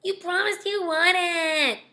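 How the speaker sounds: put-on high, drawn-out childish whine; exaggerated whine. A narrator's reading. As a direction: whine it slowly, close to tears. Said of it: "Daniel whined".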